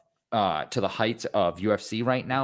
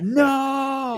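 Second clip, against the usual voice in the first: high shouted no of despair; screaming no; 11 semitones higher, 4 dB louder than usual. Retold or imitated. Fan mimicking Juggernaut fans' high desperate scream. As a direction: yell it like your fighter just fell. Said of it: "They're screaming".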